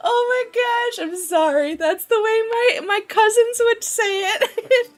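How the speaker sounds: high, laughing apology; helpless laughter. Real, not acted. Streamer apologizing for her cousins' accent, laughing. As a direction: squeeze the words out through laughter.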